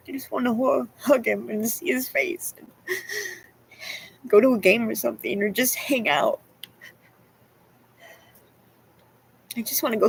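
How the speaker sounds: real crying. Real, not acted. Daughter longing to see her dad, crying as she talks. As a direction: talk through tears. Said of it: "I don't know why I'm fucking crying".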